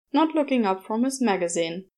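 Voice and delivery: plain reading voice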